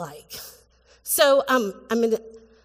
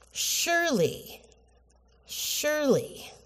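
SURELY drawn out, high then falling; emphatic; 5 semitones higher, 4 dB quieter, slower than usual. Shown as a demonstration. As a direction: stretch "Surely" slowly from a high start. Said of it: "is really emphatic".

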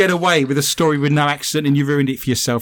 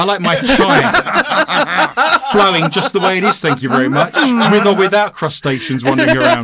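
mock indignant; 6 semitones higher, 5 dB louder than usual. Mock fussy protest, laughing.

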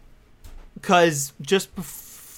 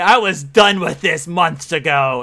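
noir tough guy; 6 dB louder, slower than usual. Retold or imitated. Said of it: "Noir V P".

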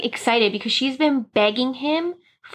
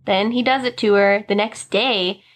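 ordinary talk

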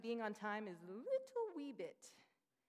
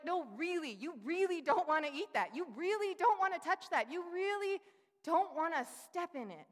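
high, loud, emphatic pleading; pleading with a child; 7 semitones higher, 9 dB louder than usual. Retold or imitated. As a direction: beg the kid to stay away. Pleading with child not to touch.

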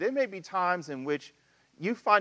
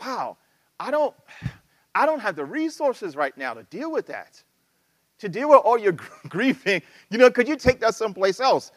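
exasperated annoyance; 5 semitones higher, 6 dB louder than usual. Retold or imitated. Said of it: "you're like".

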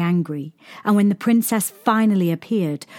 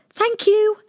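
pleased princess; 4 dB louder than usual. A narrator's reading. Princess thanks the boy.

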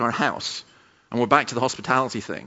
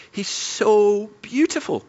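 slow, higher, hushed awe, as if through tears; tearful wonder; 8 semitones higher, much slower than usual. Retold or imitated. Crying student tells the pastor's wife about Jesus, slow tearful awe.

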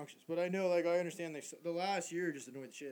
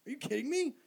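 high, louder, laughing in disbelief; incredulous rant; 10 semitones higher, 8 dB louder than usual. Real, not acted. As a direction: rant at the owners, half laughing. Ranting about ticket prices.

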